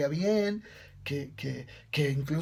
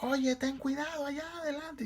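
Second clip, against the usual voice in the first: the flock calls down to the goose, high warning cries; 9 semitones higher, 3 dB quieter than usual. A narrator's reading. Flock warns the resting goose, high calls. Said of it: "le empezaron a gritar desde arriba".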